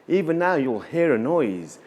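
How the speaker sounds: ordinary delivery, nothing marked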